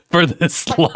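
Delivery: high, breaking up with laughter; laughing disbelief. Real, not acted. Co-host amazed the host missed it, laughing. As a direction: ask it incredulously through big laughs.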